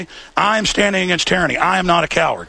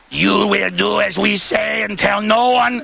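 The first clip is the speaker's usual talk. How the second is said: slow, imitated Russian accent; 2 semitones higher, slower than usual. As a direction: order them around in a mock Russian accent. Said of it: "That's more like a Russian accent".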